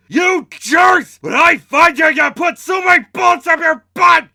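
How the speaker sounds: very high, very loud furious roar; roaring shout. Acted. Tiger threatening his attackers at the top of his lungs, furious roar. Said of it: "he roared".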